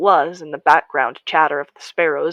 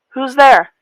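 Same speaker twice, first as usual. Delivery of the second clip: louder and higher, who's there; calling out; 8 semitones higher, 10 dB louder than usual. A narrator's reading. Narrator calls out to the stranger, loud. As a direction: call it out to the dark. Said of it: "I called again".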